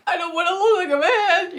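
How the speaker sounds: high, hammed-up crying whine; mock wail. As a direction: wail it like a pretend tantrum. Mock wail at being called man.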